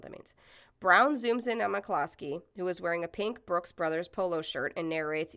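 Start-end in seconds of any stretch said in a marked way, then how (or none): none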